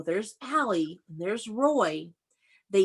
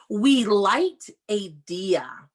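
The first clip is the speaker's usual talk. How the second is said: slow read-aloud; much slower than usual. Shown as a demonstration. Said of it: "reading slowly to children".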